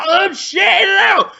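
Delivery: high, wild triumphant yelling; hammed-up crazed screaming; mock frenzied shout. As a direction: shriek it like a chef gone mad. Acted Ramsay frenzy with needles.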